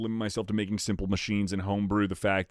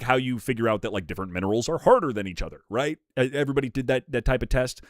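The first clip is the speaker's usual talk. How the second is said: normal talking voice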